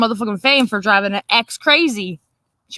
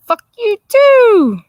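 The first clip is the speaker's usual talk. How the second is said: high, loud, slow drawn-out yell; furious retort; 12 semitones higher, 8 dB louder, much slower than usual. Retold or imitated. Snapping back at her husband's ex, yelling. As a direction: hurl it at her like a curse. Angry retort to ex's texts.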